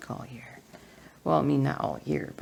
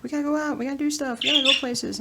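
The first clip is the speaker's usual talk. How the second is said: much higher restless mimicry of husband; mock the restless husband, pitched up; husband impression; 10 semitones higher, 3 dB louder, faster than usual. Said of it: "He's like".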